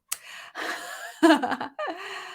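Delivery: high, light run of laughter; real laugh. Real, not acted. Host laughing after apologising to a viewer, high giggle. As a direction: giggle helplessly for a moment.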